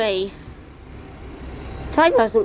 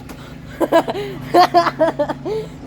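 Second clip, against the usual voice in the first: high burst of laughter; real laughter; 4 semitones higher than usual. Real, not acted. Filmer reacting to a near miss, real high laughter.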